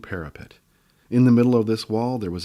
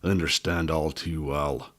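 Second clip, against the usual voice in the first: grumbling; 5 semitones lower, 3 dB quieter than usual. A narrator's reading. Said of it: "the little man grumbled".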